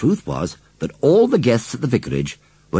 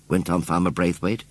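ordinary delivery, nothing marked

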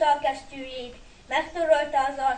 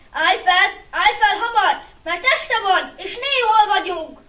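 loud, high, alarmed; anxious command in rising panic; urgent urging; 5 semitones higher, 7 dB louder than usual. A narrator's reading. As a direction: press your hurt brother to move. Urging fallen brother to get up.

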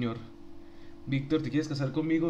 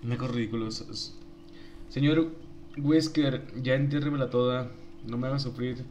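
ordinary talk

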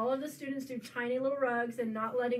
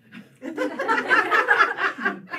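loud laugh; 7 semitones higher, 11 dB louder than usual. Real, not acted. Laughs at own joke.

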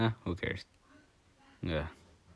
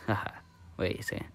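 usual speaking voice

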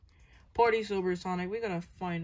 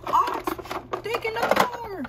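alarmed cry; 6 dB louder than usual. Pretend. Character alarmed at stolen car.

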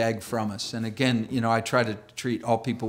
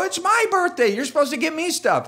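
put-on indignant kid; 14 semitones higher, 5 dB louder than usual. Kid insists on birthday.

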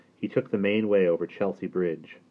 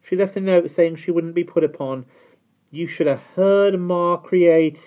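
put-on high, loud young girl voice; high excited child voice; girl's voice; 12 semitones higher, 10 dB louder than usual. A narrator's reading. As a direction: voice Dido as a chattering girl. Dido reports on Ma.

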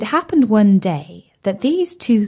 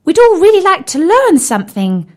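high, loud, eager squeal; excited; 10 semitones higher, 7 dB louder than usual. A narrator's reading. Tim the tadpole urging Bertie on, excited. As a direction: bounce on the words, very high and loud. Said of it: "said Tim excitedly".

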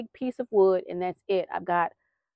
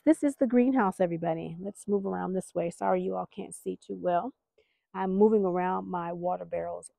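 ordinary delivery

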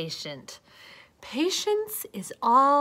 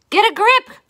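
pep talk; 8 semitones higher, 10 dB louder than usual. Acted.

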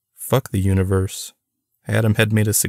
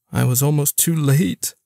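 a tearful, breaking admission; choked-up relief after a close call; through sobs; 6 semitones higher than usual. A narrator's reading. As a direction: voice catching on a near sob. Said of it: "he said through sobs".

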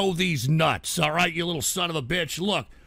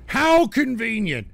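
sarcastic; 4 semitones higher, 5 dB louder, slower than usual. Real, not acted. Sarcastic jab at Moon Man.

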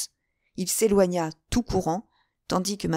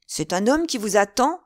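higher, louder jealous question; exclaiming; 4 semitones higher, 6 dB louder than usual. A narrator's reading. D'Artagnan learning a man awaits her, startled outcry. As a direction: cry out in startled disbelief. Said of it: "s'écria D'Artagnan".

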